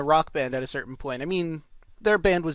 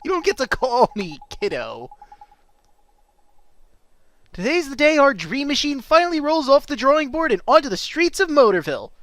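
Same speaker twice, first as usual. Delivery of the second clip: lively, higher-pitched cartoon delivery; game character voice; 10 semitones higher, 5 dB louder than usual.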